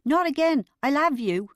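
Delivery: loud, high telling-off; roared scolding. A narrator's reading. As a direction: shout a threat across the room. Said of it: "Barry Grant roared at him".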